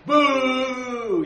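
loud mocking boo from a crowd; jeering army boo. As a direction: jeer it like a hostile army. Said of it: "Philistines going".

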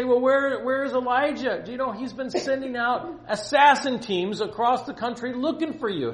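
high, loud mock panic; freaking out. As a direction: rant in acted alarm. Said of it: "Obadiah's freaking out".

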